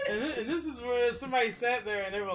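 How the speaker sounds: high and loud through laughter; laughing. Real, not acted. Laughing at the idea while telling cohosts laughing. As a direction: talk while cracking up.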